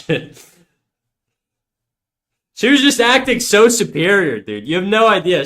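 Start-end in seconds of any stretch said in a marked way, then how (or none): 2.5-5.5 higher, louder indignant venting; worked-up complaint. Real, not acted. Streamer complaining his ex acted superior, worked up. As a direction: vent indignantly, pitched up.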